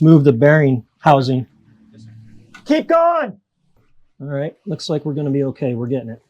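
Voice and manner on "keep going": calling out. Real, not acted. Calling to crew at boat.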